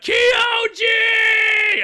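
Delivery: high, loud, drawn-out anime yell; shouting. Retold or imitated. Fan imitating the actor's episode after episode yelling, hammed-up shout. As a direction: scream the name at full lung power. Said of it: "would just constantly shout".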